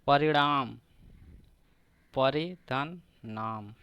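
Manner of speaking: ordinary talk, nothing marked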